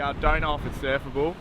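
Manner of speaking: ordinary talk, nothing marked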